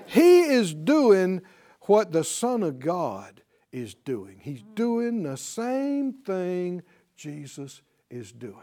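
very slow, drawn-out preaching; slow right down, letting the voice sink away; awed emphasis. Real, not acted. Preacher slows to savour the point.

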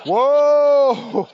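mock doom-laden preacher moaning; gloomy preacher. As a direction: lament like a dour preacher. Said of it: "the preacher goes".

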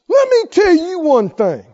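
loud, high-pitched acted telling-off; worked up. Speaker losing control mid-sentence, loud and worked up. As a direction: let loose as if temper takes over. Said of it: "You can get all worked up".

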